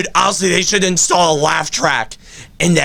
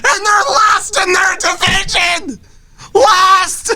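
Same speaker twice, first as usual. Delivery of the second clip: exasperated shout. Real, not acted. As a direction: yell it in disbelief. Comic despair.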